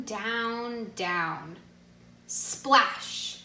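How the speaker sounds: ordinary delivery, nothing marked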